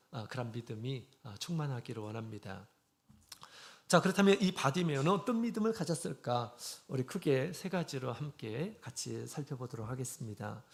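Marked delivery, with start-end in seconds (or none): none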